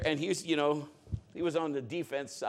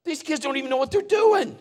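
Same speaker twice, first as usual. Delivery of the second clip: re-enacted livid outburst, high and loud; exasperated shout from the sideline; livid dad; 10 semitones higher, 7 dB louder than usual. Dad fuming over son's untrained football team, livid shout. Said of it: "just almost livid".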